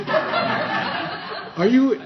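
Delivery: high, loud, laughing disbelief; shocked laughing. Retold or imitated. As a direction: shout it through laughter. Retold shocked exclamation, laughing.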